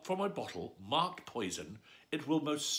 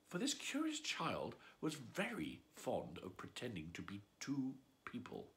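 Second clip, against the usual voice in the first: softer, whispered secret; whispered aside; 8 dB quieter than usual. A narrator's reading. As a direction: confide it in a whisper. Confiding narration aside.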